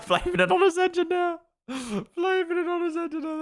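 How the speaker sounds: high laughing voice; cracking up at the driver's excuse. Real, not acted.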